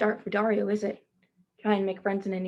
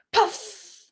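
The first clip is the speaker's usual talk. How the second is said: whale puff; 9 semitones higher, 5 dB louder than usual. Acted. Reading the whale's spout.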